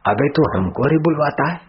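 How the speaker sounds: high-pitched affronted question; mock outrage, pitch jumping up; indignant challenge. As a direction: fire back, offended at being told what to say. Officers indignantly challenge beaten youth.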